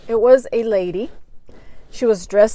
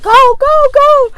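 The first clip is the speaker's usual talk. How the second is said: much higher, louder frantic scream; 9 dB louder, slower than usual. Retold or imitated. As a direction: shriek at the driver to go. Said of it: "he's screaming".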